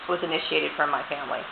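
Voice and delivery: speaking with a dry mouth; parched, sticky mouth. Real, not acted. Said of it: "my mouth is so dry".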